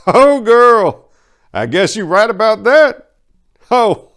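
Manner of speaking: high and loud, still amused; laughing crime boss. Acted. Duke Willie amused by Maria's answer, high and loud. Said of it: "roared in laughing approval".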